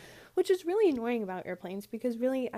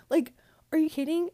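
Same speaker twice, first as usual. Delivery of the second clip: indignant disbelief; 6 semitones higher, 6 dB louder than usual. Real, not acted. Outraged about short layover.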